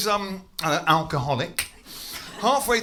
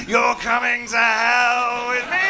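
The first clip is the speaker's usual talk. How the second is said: demonic shout; 6 semitones higher, 4 dB louder, slower than usual. Pretend. Drunk forcing more drink.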